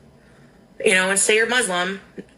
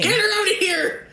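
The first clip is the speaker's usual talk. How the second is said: mock outrage; 11 semitones higher than usual.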